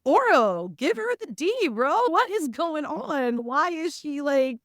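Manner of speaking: high, loud mock plea; exasperated. Reader scolding Oro on Isla's behalf, exasperated. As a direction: plead with him in exasperated disbelief.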